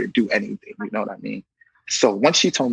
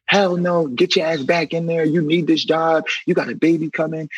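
mock the aunt telling him off; a retold aunt's firm scolding; 5 semitones higher than usual. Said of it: "She's like".

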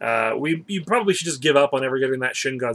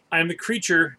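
normal talking voice

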